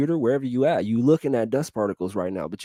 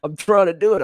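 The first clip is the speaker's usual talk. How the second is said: laughing self-quote; 5 semitones higher, 6 dB louder than usual. Retold or imitated. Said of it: "'Cause I'm like".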